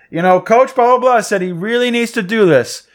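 high, loud reporter voice; imitating a sideline reporter. Mimicking the Spanish reporter's interview, high and loud. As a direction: ham up the eager reporter. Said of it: "he's like".

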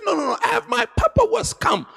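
high, insistent voice; imitating the member. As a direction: play the stubborn member. Member's reply voiced in story.

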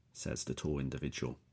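ordinary delivery

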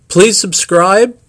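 ordinary talk

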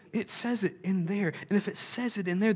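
ordinary talk, nothing marked

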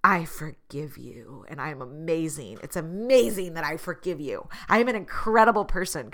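grandly bestow forgiveness, imitating someone pleased with themselves; mock haughty. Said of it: "haughty way, like".

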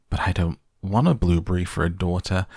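ordinary delivery

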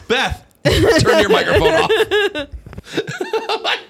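high, slow laughing plea. Real, not acted. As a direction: crack up while begging. Laughing at a cohost's slip.

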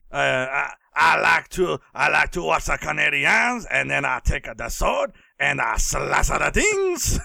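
hammed-up French Canadian accent, building at the end; badly faked Quebecois accent; mock French Canadian. Host playing the kid with the sword, mock French Canadian accent. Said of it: "he was all very, very, like, Canadian".

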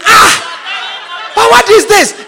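mock shock at the food; laughing what is this; surprised taster. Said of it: "you taste it".

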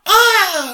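high, loud imitated movie scream; Wilhelm scream. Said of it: "just that".

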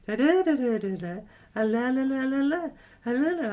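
mocking sing-song da da da, high and loud; lilting mock poetry-reading chant; same tone poet reading. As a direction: send up the poets' monotonous reading tune. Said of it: "You keep doing the same tone of voice".

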